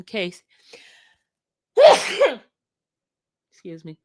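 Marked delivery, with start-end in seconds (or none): 1.8-2.4 sudden loud, high-pitched sneeze; real sneeze. Real, not acted. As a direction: sneeze explosively. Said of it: "I feel a sneeze coming on".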